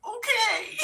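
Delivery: high, wailing mock sob; comic crying voice. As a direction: give in with a hammed-up tearful whine. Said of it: "And I'm crying, I'm literally crying".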